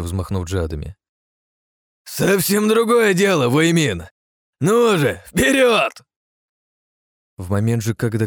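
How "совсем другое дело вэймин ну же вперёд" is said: high, loud battle taunt; excited shouting. Acted. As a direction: egg him on with fierce joy. Hero eggs opponent on mid-battle.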